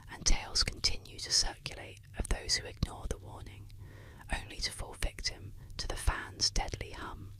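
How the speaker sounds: plain reading voice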